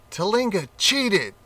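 roared. A narrator's reading. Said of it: "roared the animals".

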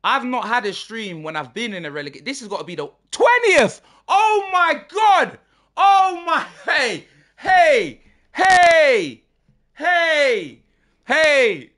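high, very loud disbelief building to screams; shocked shouting. Real, not acted. Streamer seeing his team in twentieth, shocked shouting. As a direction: erupt in shock, yelling long cries. Discovers he is in relegation zone.

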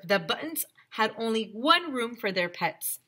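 ordinary delivery

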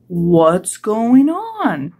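very drawn-out question; puzzled walrus. Acted. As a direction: stretch it out in a walrus character voice. Walrus voiced in the story.

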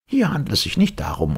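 high-pitched professor; professor rebuking. A narrator's reading. Professor rebuking the yielding captain, high voice. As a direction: correct him in a high character voice.

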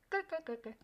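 tut-tut snicker at the brother; gloating chuckle. Acted. Narrator gloating over her brother's fate, soft snicker.

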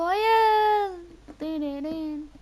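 high, loud yelled no; desperate shriek; panicked scream. Real, not acted. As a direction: scream it in panic as the team falls. Teammate about to lose.

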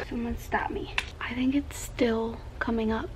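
soft whisper; breathy whisper. Real, not acted. Quiet while baby naps.

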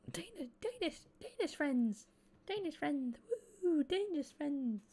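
very high falsetto, goofy and playful; high silly voice. Acted. Mock singsong about Danish friends.

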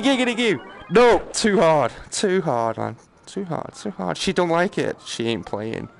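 ordinary talk, nothing marked

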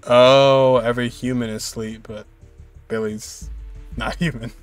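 ordinary talk, nothing marked

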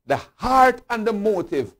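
ordinary delivery

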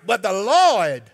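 "Lord" drawn out slow as "Lawd", the vowel open and long. Shown as a demonstration. Preacher spelling it out for the church, slow "Lawd". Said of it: "L A W D".

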